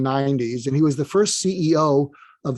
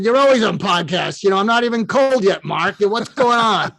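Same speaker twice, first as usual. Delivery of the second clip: exasperated Brooklyn accent impression; Larry King; 4 semitones higher than usual. Freshly dead mentor woken by Mark, exasperated Brooklyn accent. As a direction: complain in comic Brooklynese. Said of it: "in his Brooklyn accent".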